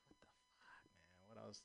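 hushed, unvoiced what the fuck; whispered disbelief. Complaining about seeds in free weed, whispered. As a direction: breathe it out in a whisper.

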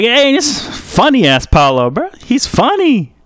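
high-pitched mock heckler; high caricature voice, drawing out the words; imitated bystander. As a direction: exclaim it in a high put-on voice.

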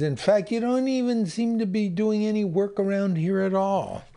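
high, mocking worker jab; jeering worker voice. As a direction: needle the boss in a high voice. Last worker voiced from cartoon.

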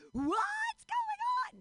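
very high, slow panicked squeal; hammed-up high-pitched scream; screaming eggs. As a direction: shriek as tiny panicking eggs. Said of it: "My eggs are screaming".

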